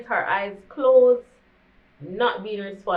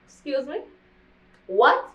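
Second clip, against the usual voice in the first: stunned disbelief; 5 semitones higher than usual. Retold or imitated. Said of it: "And I was like".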